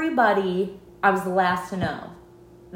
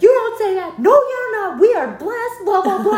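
very high, loud preachy scold; mock pious relative; 13 semitones higher, 8 dB louder than usual. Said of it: "he was like".

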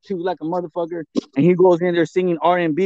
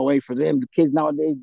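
normal talking voice

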